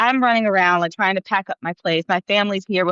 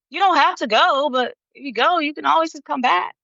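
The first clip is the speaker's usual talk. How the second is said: gentle mother; 8 semitones higher than usual. Retold or imitated. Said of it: "she was just like".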